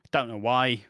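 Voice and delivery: normal talking voice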